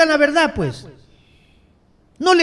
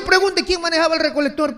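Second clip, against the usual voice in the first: normal talking voice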